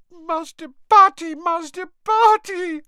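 very high, loud elf wail; sobbing. A narrator's reading. House elf pleading through her hands, sobbing. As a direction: wail his name in a squeaky sob. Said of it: "sobbed Winky through her hands".

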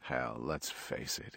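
low, hushed aside to oneself; inward thought. A narrator's reading. Star thinks smugly to himself, low and quiet. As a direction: confide it privately, very softly. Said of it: "Jason grinned inwardly to himself".

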